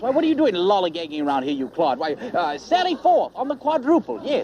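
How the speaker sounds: haughty put-on British officer voice; fake British accent. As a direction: bluster like a British admiral. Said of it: "you can drop that dreadful accent now".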